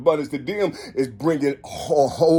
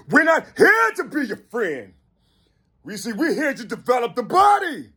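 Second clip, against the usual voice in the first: preaching shout; 6 semitones higher than usual. Real, not acted. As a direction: proclaim it like a street preacher. Preaching rant builds to shouting.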